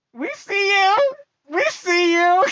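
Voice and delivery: high, taunting call-out; teasing call-out. Real, not acted. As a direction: let them know they're caught. Calling out older generation.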